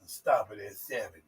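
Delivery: hushed low aside; whisper. Retold or imitated. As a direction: lean in and murmur it low.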